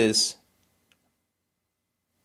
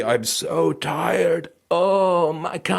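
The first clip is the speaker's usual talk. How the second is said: put-on tired child; 3 semitones higher, much slower than usual. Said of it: "the mind of a child".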